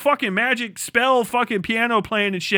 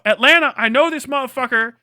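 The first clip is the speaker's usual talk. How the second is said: ordinary talk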